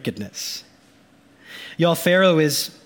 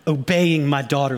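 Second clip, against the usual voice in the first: normal talking voice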